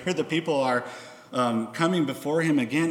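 ordinary delivery

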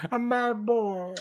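high, slow put-on movie voice; Dark Knight Rises impression. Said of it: "Dark Knight Rises impression".

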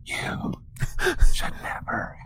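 low, unvoiced ghostly whisper; menacing whisper. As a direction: whisper like a spirit in their head. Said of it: "in a low, and menacing whisper".

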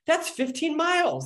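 high-pitched retold exclamation of amazement; astonished judge. Judge hears about the bike ride, retold astonishment.

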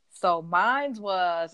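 slow, drawn-out "mine's was" with a hard final s on "mine's"; slow playful mine's. Retold or imitated. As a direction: stretch "mine's was" out teasingly. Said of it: "I was like".

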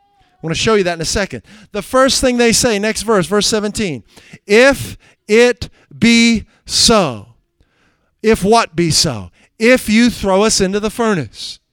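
fiery sermon delivery; preaching at full force. Acted. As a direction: thunder out the scripture. Explaining the scenario.

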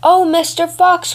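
the cat crying out over the fox. Acted. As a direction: call down to the caught fox. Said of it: "cried the cat".

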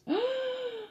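long, high mock-scandalized gasp; shocked gasp. Hearing co-host faked a note, gasping in shock. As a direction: gasp loudly at a naughty confession.

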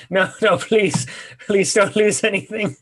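high and loud panic; panicked. Real, not acted. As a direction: beg the game not to lose it. Panics about losing progress.

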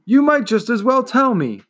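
put-on boy arguing with the stubborn turtle. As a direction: argue the point back. Continues arguing.

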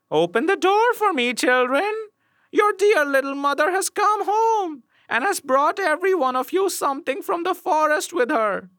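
very high false-mother voice; wolf's softened voice. A narrator's reading. Wolf's third try as dear little mother, high coaxing voice. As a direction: coax them in a sweetened high pitch. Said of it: "made his voice soft with it".

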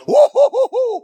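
high, loud woo-hoo; joyful whoop. Real, not acted. Preacher cheering the deliverance with a high, loud whoop. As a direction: whoop it out in celebration. Celebrating a thousand demons cast out.

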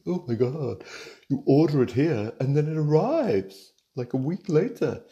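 high, bright gushing wonder; hammed-up wonder at online shopping; mock amazement. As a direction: gush about it like it's magic.

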